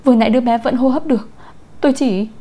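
frightened, urgent excuse; panicked defense. A narrator's reading. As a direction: plead ignorance in a panic. Blamed nanny stammers a defense.

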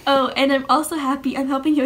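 ordinary talk, nothing marked

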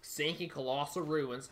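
ordinary talk, nothing marked

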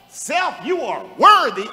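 "Self" called out high and loud; stress on Self. Shown as a demonstration. Preacher declaring himself worthy as a demonstration, stress on "Self". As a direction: punch "Self", keep the line high and forceful. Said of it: "Emphasis on who?".

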